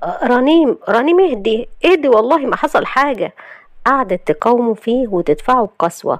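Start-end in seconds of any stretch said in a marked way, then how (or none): 0.0-3.3 higher-pitched, pressing reassurance; urgent calming. Acted. Husband trying to calm sobbing wife, urgent. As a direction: plead with her to settle down. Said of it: "حاول ان هو يهديها".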